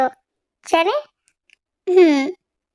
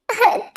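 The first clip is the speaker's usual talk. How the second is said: giggle; 5 semitones higher than usual. Acted. Jimin can't stop laughing.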